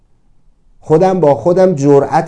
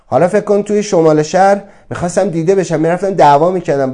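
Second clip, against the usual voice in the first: normal talking voice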